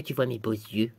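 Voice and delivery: very low, soft playful voice; mock seductive. Showing off eyes after removing glasses, mock-seductive low voice.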